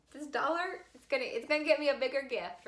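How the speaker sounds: high, fast excited chatter; giddy greed. Retold or imitated. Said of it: "I'm thinking".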